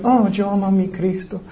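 high, gushing mock devotion, easing down quickly; hammed-up adoration, then a quick throwaway admission; mock professed believer. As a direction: imitate someone declaring love a little too much. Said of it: "Hay muchas personas que dicen".

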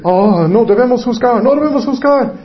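mimicked objectors. Preacher mimics people who object to judging, mock protest. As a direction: whine it high like scandalized objectors.

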